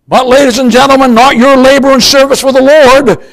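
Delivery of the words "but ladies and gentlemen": normal talking voice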